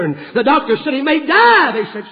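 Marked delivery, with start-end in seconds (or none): 0.9-1.7 high and loud warning; alarmed doctor's warning. Retold or imitated. Doctor warning the family at the hospital, high and loud. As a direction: cry out the danger as the doctor.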